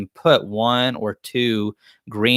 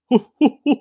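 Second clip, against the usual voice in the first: high, hooting cartoon chuckle; Barney Rubble chuckle; 4 dB louder than usual. Capping a joke at Fred, imitated Barney Rubble chuckle. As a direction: put on a cartoon sidekick's hoo-hoo laugh. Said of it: "a terrible impression of Barney".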